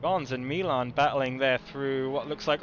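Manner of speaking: normal talking voice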